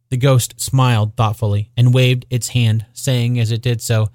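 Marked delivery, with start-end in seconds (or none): none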